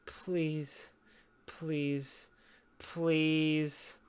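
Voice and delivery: low, soft, spaced-out begging; small, subdued plea; quiet pleading. Real, not acted. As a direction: plead softly into the silence. Begging the silent co-host.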